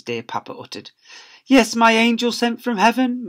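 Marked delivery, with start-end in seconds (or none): 1.5-3.3 high, louder gushing dad voice; doting father. Acted. Father gushing to his son in class, high doting voice. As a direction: gush over the child sweetly. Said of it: "Mister Lovey-dovey would ask".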